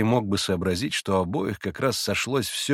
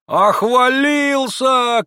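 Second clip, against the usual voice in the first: much higher, louder, slow mocking cry; shouted jeer; 8 dB louder, much slower than usual. A narrator's reading. Rakitin shouts a jeer at Alyosha. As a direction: jeer it out loud. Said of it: "крикнул Ракитин".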